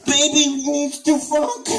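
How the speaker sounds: put-on slow, high babyish plea; plain baby voice take. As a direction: beg it slowly. Repeated begging in baby voice.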